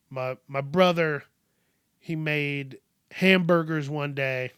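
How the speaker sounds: ordinary talk, nothing marked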